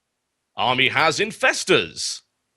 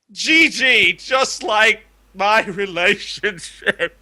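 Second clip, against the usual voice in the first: laughing mock lament; 6 semitones higher, slower than usual. Mock tragic ending, laughing.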